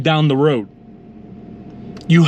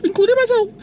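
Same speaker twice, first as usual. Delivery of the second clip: squeaky aside; 13 semitones higher, faster than usual. Shown as a demonstration.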